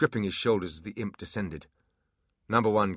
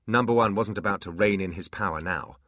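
ordinary delivery, nothing marked

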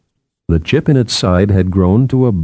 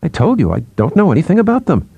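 quick, higher, exasperated reply; impatient; 8 semitones higher, faster than usual. A narrator's reading. Psychologist brushes off colleague's question about stars, impatient. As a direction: rush it out, pitch climbing with irritation. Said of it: "Shirin repeated impatiently".

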